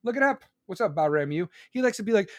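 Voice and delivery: normal talking voice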